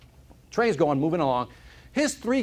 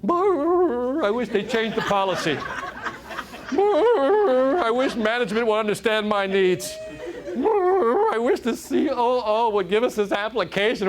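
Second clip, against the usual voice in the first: much higher and louder, growling brr between gripes, laughing; whiny office complainer; 7 semitones higher, 5 dB louder, slower than usual. Retold or imitated. Office worker grumbling about management, high whiny growl with laughs. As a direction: ham up the chronic complainer. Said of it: "that one person complaining".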